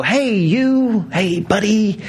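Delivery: high-pitched, put-on hail to a stranger; mock greeting. Preacher mimicking someone walking around heaven, mock clueless greeting. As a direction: pretend to call to someone whose name escapes you.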